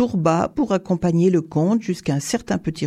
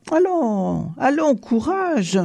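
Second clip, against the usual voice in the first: encouraging; 7 semitones higher, slower than usual. A narrator's reading. Count encourages the frightened Tourba.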